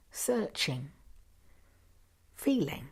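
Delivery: ordinary delivery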